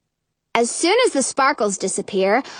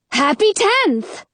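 fairy voice; 9 semitones higher, slower than usual. Acted. Blue-haired fairy.

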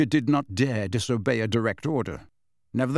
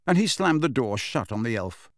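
ordinary delivery

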